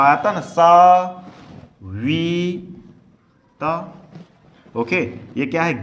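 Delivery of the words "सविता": सविता drawn out, each syllable long; slow sounding out. Shown as a demonstration. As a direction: stretch सविता out slowly. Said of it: "धीरे से बोल रहे हैं". (सविता को बहुत धीरे खींचकर)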